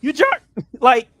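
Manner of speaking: high, loud, exasperated outburst; frustrated rant. Real, not acted. Backer rants at the company over changed numbers, loud and frustrated. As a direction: raise pitch and volume, accusing them hotly. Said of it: "I'm so fucking frustrated".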